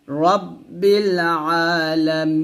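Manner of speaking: রব্বিল আলামিন with the মি stretched three counts; three-beat madd. Shown as a demonstration. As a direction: hold the long vowel in আলামিন for three beats, end on a still নুন. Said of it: "তিন লিপ টান দেবো এরকমভাবে".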